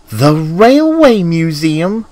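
high, drawn-out astonished question; excited. A narrator's reading. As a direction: exclaim it slowly, thrilled, pitch right up. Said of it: "whistled Thomas excitedly".

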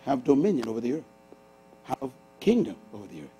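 usual speaking voice

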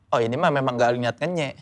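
a quiet shrug of a line; flat, unbothered reaction. Retold or imitated. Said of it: "Jadi orang".

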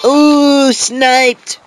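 high, loud excited yell; excited shout. Real, not acted. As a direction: cheer it out loud. Cheering a snipe kill.

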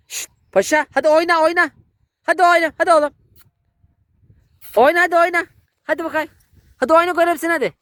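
high, loud urging to play; coaxing the dog. Real, not acted. Owner urging her tired dog to play, high loud coaxing.

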